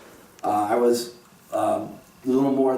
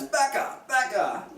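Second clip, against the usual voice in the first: imitated yell on a raised pitch; mock yell; 11 semitones higher, slower than usual. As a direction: call the name out high, as if out a window. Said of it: "yelled out the window".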